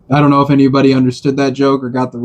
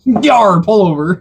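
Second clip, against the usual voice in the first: higher-pitched joking pirate growl; 7 semitones higher than usual. Pretend. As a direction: play a cartoon pirate pulling someone over. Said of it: "or the pirate, pilot".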